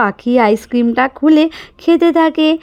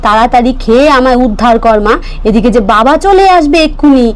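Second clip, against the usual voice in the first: louder scolding mother; 3 semitones higher, 5 dB louder than usual. Acted. Mother scolding Pakhi to hurry, loud. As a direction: call out sharply to the child.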